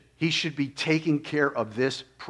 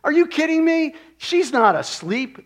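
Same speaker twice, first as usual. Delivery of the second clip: mocking ridicule; 11 semitones higher, 5 dB louder than usual. Mourners scoffing at Jesus at the house, mocking. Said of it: "they laugh. They ridicule".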